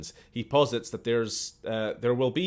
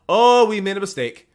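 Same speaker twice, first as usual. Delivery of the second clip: surprised; 9 semitones higher, 11 dB louder than usual. Real, not acted. Realising error mid reading.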